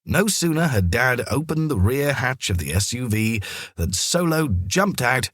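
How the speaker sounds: usual speaking voice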